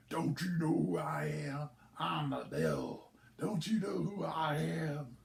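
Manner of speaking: slow, lightly menacing devil; mock devil. Devil getting in the old man's face, mildly menacing.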